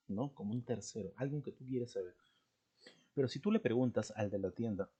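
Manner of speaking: ordinary talk, nothing marked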